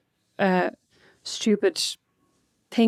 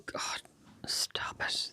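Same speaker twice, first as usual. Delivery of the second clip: hushed stop it; whisper; 16 dB quieter, much slower than usual. Real, not acted. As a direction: whisper it, embarrassed. Embarrassed at Christmas talk.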